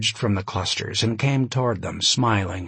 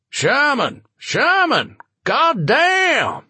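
very high, loud booming shout; 5 dB louder, much slower than usual. Acted. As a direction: boom his name across the room. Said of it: "A booming voice".

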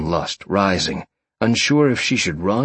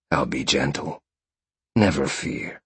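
low, soft male character voice; gentle reassurance; 7 semitones lower, 4 dB quieter than usual. A narrator's reading. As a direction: reassure softly, pitched well down. Big lover reassures his nervous partner.

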